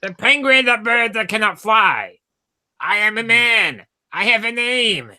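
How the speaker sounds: high, strained Penguin impression; Danny DeVito Penguin. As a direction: squawk it like the Batman villain.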